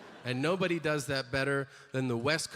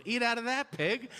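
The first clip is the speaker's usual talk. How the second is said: mocking drive-thru worker; 7 semitones higher than usual. Worker mocking customer, shifted voice.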